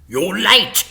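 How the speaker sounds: snarled. A narrator's reading. Mister Big to his late henchmen, snarling. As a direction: bark it at the latecomers. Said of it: "snarled Mister Big".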